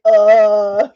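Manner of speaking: loud imitated "uh" of shock; shocked cry. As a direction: blurt a loud startled noise, retelling the fright. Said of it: "it just shocked me".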